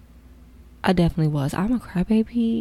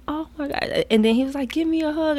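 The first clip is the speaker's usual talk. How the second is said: higher, thrilled greeting; thrilled reunion; excited; 9 semitones higher than usual. Retold or imitated. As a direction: gush like an old friend. His excited reaction quoted.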